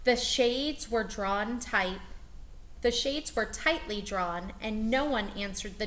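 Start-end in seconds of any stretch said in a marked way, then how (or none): none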